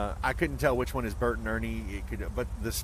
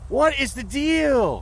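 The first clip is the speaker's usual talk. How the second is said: exasperated; 12 semitones higher, 6 dB louder, much slower than usual. Real, not acted. Baffled nobody takes the car.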